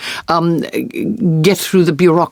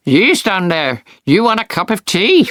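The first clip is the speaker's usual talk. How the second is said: imitated stranger calling from a window, rising in pitch; 5 semitones higher than usual. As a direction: put on the voice of someone offering tea from above. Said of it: "a voice from the window said".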